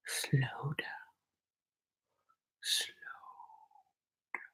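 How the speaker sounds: whisper. Shown as a demonstration. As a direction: whisper "Slow down" to yourself. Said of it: "let me slow down".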